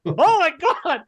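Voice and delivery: high startled exclamation; pained yelp. Retold or imitated. Uncle Leo burned by a hot pepperoni, startled. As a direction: react to hot food in your lap.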